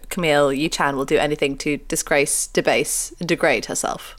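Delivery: low, quiet mock review voice; mock solemn reviewer. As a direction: read it like a stern critic. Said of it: "As one reviewer said of our show".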